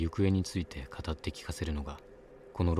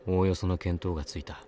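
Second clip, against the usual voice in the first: ordinary delivery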